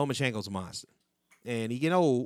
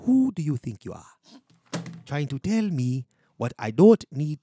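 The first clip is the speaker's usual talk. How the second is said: emphatic put-on African accent. Host challenging a rival podcaster, indignant African accent. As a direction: boast grandly in a mock African accent.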